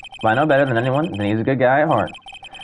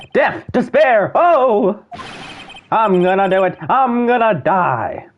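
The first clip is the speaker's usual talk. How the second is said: hammed-up despair; 7 semitones higher, slower than usual. [name] wailing in the lobby, high melodramatic despair. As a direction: cry out like it's the end.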